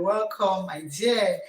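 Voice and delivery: ordinary talk, nothing marked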